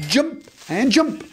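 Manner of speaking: high, drawn-out playful commands; slow, high-pitched game cheer; excited calling. Real, not acted. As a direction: call each jump out gleefully.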